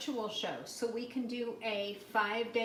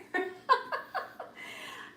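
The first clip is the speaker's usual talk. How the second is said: light laugh. Real, not acted. Laughs at own joke.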